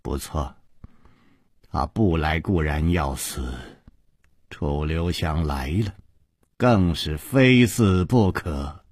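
low, soft, deliberate menace; cold villainess. Acted. Yinji telling Chu Liuxiang of her killing, quiet menace. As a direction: pronounce it quietly and low, unhurried.

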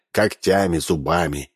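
usual speaking voice

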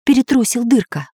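ordinary delivery, nothing marked

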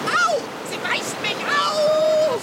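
high, loud, slow howl of pain; pained cry. Acted. Bitten cat wailing at Tarzan in pain. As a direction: yelp as the teeth go in.

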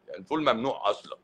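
ordinary delivery

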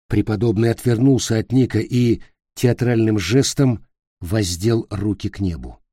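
ordinary delivery

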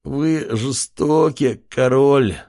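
slow weary moan. A narrator's reading. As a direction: complain through the pain. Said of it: "постановал старик".

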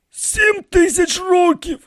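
very high, slow falling statement; musing old priest. A narrator's reading. High priest estimates his age, slow and musing. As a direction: state it slowly, as a tentative guess.

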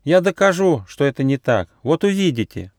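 high, hurt protest; offended. A narrator's reading. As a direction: insist in a small high voice. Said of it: "обиделся мышонок".

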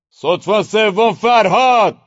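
drawn-out, very high, loud summons; general's bellow. A narrator's reading. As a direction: roar the call slowly across camp. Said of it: "از چادرش در اومد و داد زد". (فریاد کشدار ژنرال)

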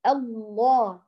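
الله with both lams full and heavy; heavy lam in Allah. Shown as a demonstration. Teacher modelling the correct heavy lam. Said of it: "فنقول هكذا".

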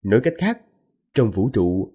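ordinary delivery

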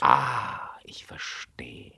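whispered slow realization, trailing away very soft; shocked whisper. Acted. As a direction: breathe it out as it dawns on him. Sudden realization of the affair.

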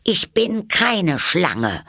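high, loud, slow indignant protest. A narrator's reading. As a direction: deny it, offended and firm. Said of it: "sagte Alice entrüstet".

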